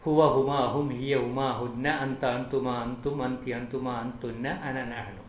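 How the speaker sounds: ഒറ്റ ശ്വാസത്തിൽ ചൊല്ലുക; in one breath. Shown as a demonstration. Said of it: "ഒരൊറ്റ ശ്വാസത്തിൽ അത് പറയണം".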